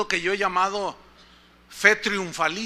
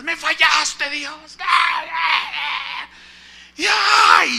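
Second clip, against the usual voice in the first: high, loud wailing cry at God; crying shout; 7 semitones higher, 4 dB louder, much slower than usual. Retold or imitated. New mother cries out at God, wailing shout. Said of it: "y lloraba y gritaba".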